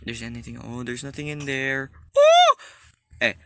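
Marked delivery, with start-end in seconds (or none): 2.2-2.5 loud, very high startled shriek; high-pitched scream. Real, not acted. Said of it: "in a very high pitch".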